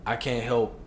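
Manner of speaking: ordinary delivery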